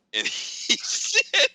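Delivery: barely getting it out for giggling; laughing. Real, not acted. Laughing at his friend's dead-cat joke, high laughing.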